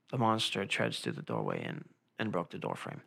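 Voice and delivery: very low, soft storyteller voice; low monster-story narration; dramatic audiobook voice. Shown as a demonstration. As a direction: narrate it deep, hushed and ominous. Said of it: "how I made my voice sound".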